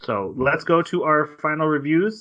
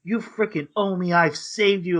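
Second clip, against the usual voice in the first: higher, lightly voiced desperate demand; imitating a desperate ranting boyfriend, higher; mock rant; 6 semitones higher than usual. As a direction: rant at her half-heartedly. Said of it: "he starts ranting".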